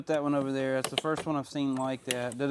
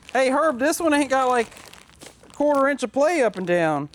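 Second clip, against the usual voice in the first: excited call; 8 semitones higher, 6 dB louder than usual. Real, not acted. Calling out to Herb.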